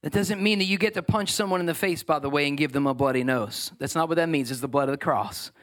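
ordinary delivery

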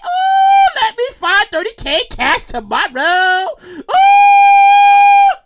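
high, loud whooping cry; mock rejoicing. Imagining he finds cash tomorrow, mock rejoicing. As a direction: wail with pretend joy at the windfall.

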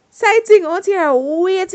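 ordinary delivery

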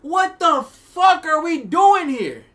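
high, loud, slow outraged shout; outraged yell. Retold or imitated. Stephon Marbury learns there's no prenup, shouting high and slow. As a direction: blow up at the wedding. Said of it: "he's all like".

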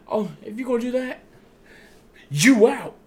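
high, fast, then a loud jab at the end; mock wrestler calling Jimmy out; retold threat. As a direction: fire off the threat quickly, pitched high.

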